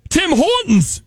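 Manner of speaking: high, hammed-up Canadian; mock Canadian, pitched well up; Canadian accent. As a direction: do a silly fake Canadian accent.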